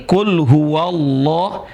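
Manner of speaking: slow "kul huwallah" with no A; linked reading. Shown as a demonstration. Teacher shows the hamza dropped mid-phrase. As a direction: glide huwa into llah slowly. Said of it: "A-nya nggak dibaca".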